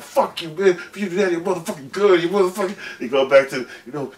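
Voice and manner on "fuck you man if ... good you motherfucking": put-on much higher, laughing drunk rant. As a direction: play the sloppy drunk cursing the bailiff. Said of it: "Next minute he's like".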